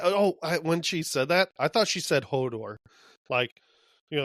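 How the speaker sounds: ordinary delivery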